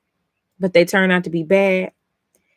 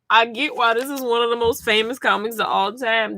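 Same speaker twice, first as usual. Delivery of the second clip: ordinary delivery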